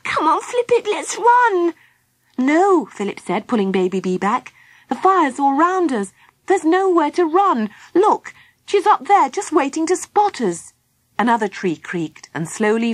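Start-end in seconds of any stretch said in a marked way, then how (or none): 0.0-1.7 very high little creature, urging escape; high-pitched urgent squeal; panicked Baby Bee. Acted. 2.4-2.9 high, loud cry to stop; alarmed, forceful objection; urgent refusal. Acted. Philip stops Baby Bee fleeing. 4.9-10.7 high, urgent voice of someone trapped; anxious, high-pitched insistence; alarmed warning. Acted. Philip warns they are trapped.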